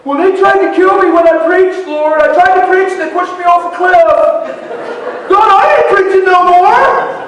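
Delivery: high, loud fed-up complaint; Jesus throwing up his hands, shouting he's done; exasperated Jesus. Acted. Preacher voicing Jesus quitting on ungrateful people, shouting high.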